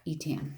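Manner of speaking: "Etam" as "ee-TAM", long E first; tentative "Etam", said ee-TAM; trying a pronunciation. Shown as a demonstration. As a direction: sound out "Etam" with ee, then TAM as in ham. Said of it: "I don't know how you pronounce it".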